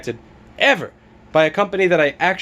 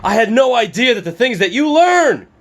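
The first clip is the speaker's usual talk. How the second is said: higher, snooty mock voice; mocking commenter voice; 6 semitones higher, 3 dB louder than usual. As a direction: sneer it like a know-it-all. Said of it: "from some fuckface saying".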